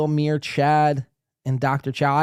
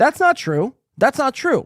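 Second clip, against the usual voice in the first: high, loud insistent denial; heated protest; 9 semitones higher, 3 dB louder than usual. Real, not acted. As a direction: protest it hotly.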